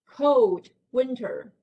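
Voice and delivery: normal talking voice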